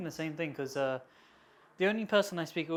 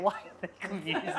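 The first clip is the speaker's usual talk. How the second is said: slow, laughing inner question; laughing quote; 3 semitones higher than usual. Retold or imitated. Retelling confusion at the family video, laughing. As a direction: giggle through the puzzled thought. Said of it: "I was like".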